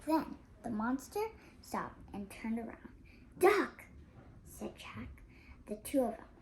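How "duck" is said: loud, sharp warning; urgent shout. A narrator's reading. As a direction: snap it out in alarm.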